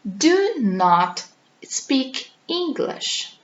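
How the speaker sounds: slow, every word separate; careful teacher demo, drawn out; slow model sentence. Shown as a demonstration. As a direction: lay out each word for the class. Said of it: "Se eu digo que eu não falo inglês".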